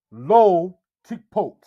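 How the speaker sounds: lo said first, slow and loud; correct word order. Shown as a demonstration. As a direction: put lo before tikpot, slowly. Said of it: "You would not say".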